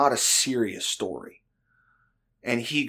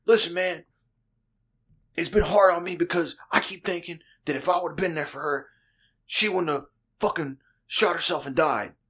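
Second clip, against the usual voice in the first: imitated buddy in a higher, earnest voice; mock-heartfelt confession, raised pitch; 6 semitones higher than usual. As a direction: play a friend unburdening himself, pitched up.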